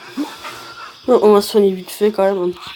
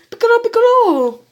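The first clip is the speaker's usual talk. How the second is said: anguished mock cry; 10 semitones higher than usual. Gohan cries out for fallen Piccolo, anguished mock cry. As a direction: wail the name over and over, hammed up. Hammed-up cries for dying friend.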